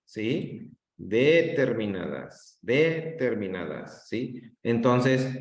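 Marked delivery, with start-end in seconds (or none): 1.0-4.1 "Determinadas" lento, con el acento en DE: DÉterminadas. Shown as a demonstration. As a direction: alarga y carga la primera sílaba de "Determinadas". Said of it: "cómo estoy haciendo el cambio". ("Determinadas" con el golpe de voz en DE)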